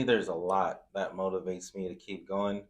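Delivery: ordinary talk, nothing marked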